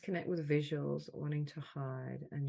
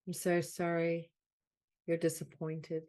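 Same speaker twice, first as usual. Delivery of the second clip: deliberate phrases, louder than the chat, long silences between; measured delivery, leaning on "so sorry"; guided healing affirmation; 5 dB louder, slower than usual. Acted. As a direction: offer each apology as a healing phrase. Leads healing phrases with long pauses.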